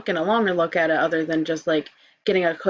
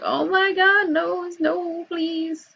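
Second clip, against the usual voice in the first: high pleading whine; pleading squeal; 12 semitones higher, slower than usual. Retold or imitated. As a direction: squeal at your own nose. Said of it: "I'm like".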